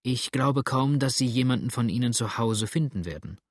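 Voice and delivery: usual speaking voice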